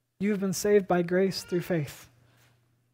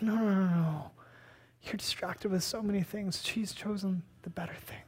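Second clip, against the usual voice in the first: soft, fading to a whisper; calm her down gently, then whisper; gentle Jesus; 7 dB quieter than usual. Retold or imitated. Jesus gently correcting frustrated Martha, softly whispered. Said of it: "And Jesus is like".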